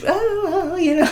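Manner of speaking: high airy innocent oh; a breezy fake-casual oh; innocent sing-song. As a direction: play it falsely innocent. Mock-innocent while cleaning pie case.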